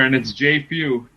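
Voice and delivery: normal talking voice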